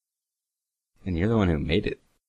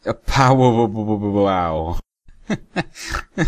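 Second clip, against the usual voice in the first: loud, high burst of wows; excited wow; 4 semitones higher, 7 dB louder, much slower than usual. Real, not acted. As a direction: blurt out the wows in amazement, fading. Reacting to absurd picture.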